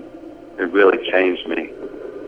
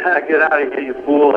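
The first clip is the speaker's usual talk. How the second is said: mocking; 5 semitones higher than usual. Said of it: "we were all just laughing and goofing".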